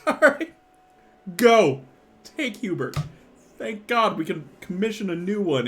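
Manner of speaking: composing himself. Acted. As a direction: get a grip after laughing, then dismiss them. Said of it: "composes himself and goes".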